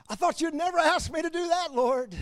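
high-pitched, gushing mock eagerness; eager mock response. Believer answering the Lord's request, high eager put-on voice. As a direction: pitch it high, as a keen reply to God. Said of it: "Is my initial response".

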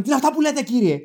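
high, put-on protest; mock-outraged high voice; imitated indignant clerk. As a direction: act a scandalised clerk answering back. Clerk protests at his accusation.

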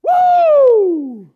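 loud, high whoop of joy; excited woo. Retold or imitated. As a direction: let out a big high "woo". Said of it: "I just went".

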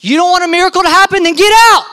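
high, loud challenge ending in a yell; indignant shout, punched at the end; shouted ultimatum. Acted. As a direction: dare them, then order them out. Jesus ejecting doubters, acted.

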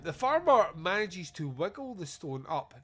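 normal talking voice

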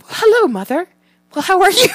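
very high, loud, sing-song politeness; suddenly angelic after a taco; sweet fed kid. Retold or imitated. Mother mimics her son turned sweet after eating, high sing-song. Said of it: "he's just, like, hungry and tired and five".

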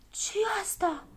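startled cry. A narrator's reading. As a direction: cry out in bewilderment. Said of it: "începură să strige cu toții".

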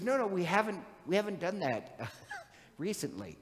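laughing denial. Acted. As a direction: brush off the accusation with a chuckle. [name] laughingly denies carousing.

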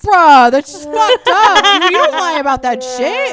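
appalled outburst. Real, not acted. Reacting to the lie about Holocaust refugees, loudly appalled. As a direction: burst out in shocked disbelief.

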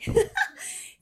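high burst of laughter. Real, not acted. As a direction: break into a short laugh. Bursts out laughing mid-sentence.

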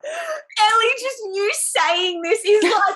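high, loud gasp, then laughing gush; overjoyed by what she hears; gasping delight. Real, not acted. Friend reading the spicy quote.

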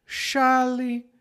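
"Chale" high and measured, opening on sh; "Chale" as a teaching word, starting sh; sh sound. Shown as a demonstration. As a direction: model "Chale" with a clear initial sh. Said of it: "normalmente tem som de sh".

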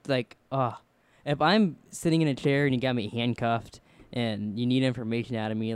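normal talking voice

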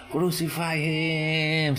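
slow mob chant crucify; mock crowd yell. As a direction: call it out like an angry mob. Crowd yells crucify him.

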